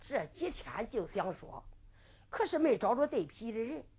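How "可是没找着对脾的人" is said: ordinary delivery, nothing marked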